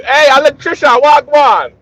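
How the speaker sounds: loud, high Jamaican greeting; Jamaican accent. Retold or imitated. Retelling the joke to the panel, hailing the electrician in a Jamaican accent. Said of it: "a lot of Jamaican people".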